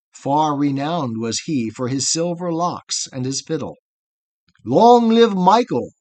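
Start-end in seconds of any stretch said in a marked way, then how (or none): 4.6-5.9 loud, high cheering shout; cry. A narrator's reading. Comrades carrying Michael the fiddler, shouting in triumph. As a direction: hail the fiddler like a jubilant crowd. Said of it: "they cried".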